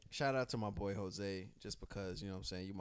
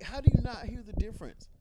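higher, louder and fast; disbelief, raised voice; incredulous; 10 dB louder, faster than usual. Real, not acted. Incredulous at co-host.

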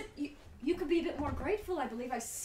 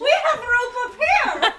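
loud, laughing yell down the stairs; laughing exasperated holler; exasperated shout; 11 semitones higher, 16 dB louder, slower than usual. Acted. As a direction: shout it out in disbelief. Calling down to the NPC [name].

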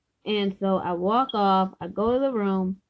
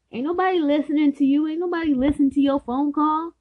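retold annoyed argument; 6 semitones higher, 4 dB louder, faster than usual. Acts out boyfriend's annoyed argument.

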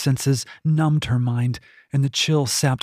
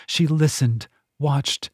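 ordinary delivery, nothing marked

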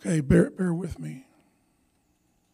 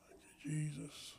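whispered prayer; whispering; 10 dB quieter than usual. Real, not acted. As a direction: breathe the thanks in a whisper. Whispered prayer while laying hands.

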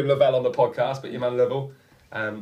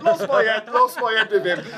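laughing; 10 semitones higher, 4 dB louder than usual. Real, not acted. Laughing after the outburst.

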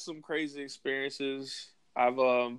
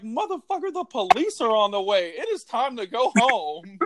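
high, incredulous mock scolding; exasperated friends; 8 semitones higher than usual. Friends telling him police are coming, high exasperated scolding. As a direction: play the fed-up crew, pitched way up. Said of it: "They were like".

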